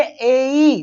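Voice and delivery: ए ई stretched very slowly; slow drawn-out vowels. Shown as a demonstration. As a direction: glide ए into ई slowly for the class. (ए ई को बहुत धीरे खींचकर बोलना)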